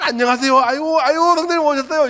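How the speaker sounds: high, fawning pastor greeting; bow and gush over a church member; pastor's greeting. Retold or imitated. Pastor welcoming a congregant at church, gushing. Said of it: "목사가 되다 보니까 맨날".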